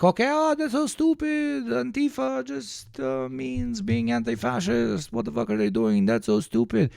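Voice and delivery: high, whiny mock of a know-it-all critic; mocking objector. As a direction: squeak it out like a scoffing skeptic. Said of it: "And before you're like".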